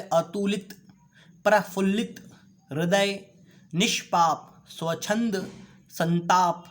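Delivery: each word spoken slowly and clearly, syllable by syllable; careful pronunciation. Shown as a demonstration. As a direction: say every word distinctly, like a teacher modelling it. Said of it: "शुद्ध उच्चारण कीजिए". (शब्दों का साफ़ और शुद्ध उच्चारण)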